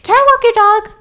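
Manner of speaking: high-pitched. Retold or imitated. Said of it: "she says".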